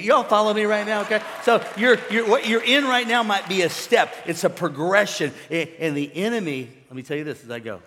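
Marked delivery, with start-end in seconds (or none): 0.0-6.7 loud, insistent preacher; full-force preaching. Real, not acted. Preacher tells church their place is a step, forceful. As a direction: drive the point home to the congregation, loudly.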